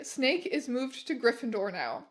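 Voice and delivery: usual speaking voice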